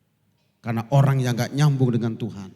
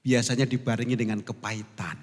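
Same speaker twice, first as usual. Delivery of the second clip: ordinary talk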